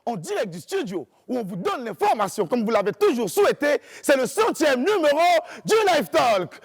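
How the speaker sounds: booming TV show intro; announcer. Acted. Show opening announcer read.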